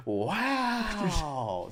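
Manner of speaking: long, loud, high "wow"; mocking wow. Heckler answers the buddy's praise, loud mocking wow. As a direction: draw it out in exaggerated disbelief. Heckler's sarcastic reply acted out.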